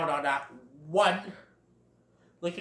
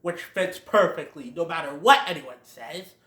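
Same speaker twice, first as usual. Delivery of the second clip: usual speaking voice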